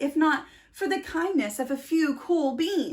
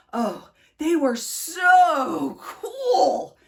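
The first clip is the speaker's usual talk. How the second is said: drawn-out awe; 3 semitones higher, 6 dB louder, much slower than usual. A narrator's reading. Admiring the cool beans.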